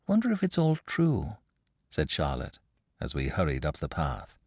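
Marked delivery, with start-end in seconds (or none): 0.1-1.4 high, light musing; acted childlike curiosity; wondering child. A narrator's reading. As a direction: voice a little girl puzzling it over. Child Charlotte wonders aloud.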